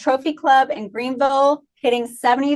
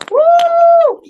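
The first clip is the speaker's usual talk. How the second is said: high, loud cheering whoop; excited woo; 9 dB louder than usual. Real, not acted. Host cheering the club streaks, loud high whoop. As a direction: whoop it up for the clubs.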